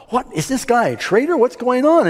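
high-pitched, agitated royal outrage; huffing indignation. Acted. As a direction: act out a flustered, fuming king. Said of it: "he's huffing around going".

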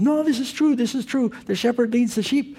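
put-on high-pitched Israeli accent. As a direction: imitate an Israeli man, pitched well up. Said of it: "Israeli accent".

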